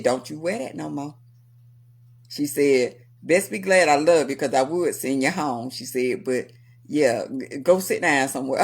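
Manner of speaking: a lightly voiced strict manager; low stern boss. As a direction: lay down the law like a caring boss.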